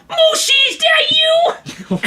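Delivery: high-pitched shouted call; crying out. Retold or imitated. Bus passenger calls to someone who isn't there, crying out. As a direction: imitate a delirious man hollering for someone. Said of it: "He cries out".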